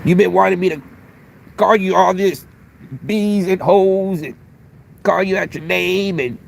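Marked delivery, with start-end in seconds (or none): none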